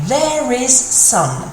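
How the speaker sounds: slowed, word by word, high start; slow literal translation. Shown as a demonstration. Teacher glossing the French word for word, slowly. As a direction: spell out the literal English, slowly. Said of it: "directly translate it".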